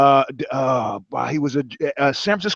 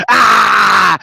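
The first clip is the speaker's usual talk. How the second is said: louder yell of triumph, barely acted; retold victory yell; 8 dB louder than usual. Retelling his win at the US Open, mock yell. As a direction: shout it as if you just won. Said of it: "I go".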